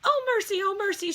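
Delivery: very high, loud fretful wail; dismayed cry. A narrator's reading. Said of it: "Stuart cried".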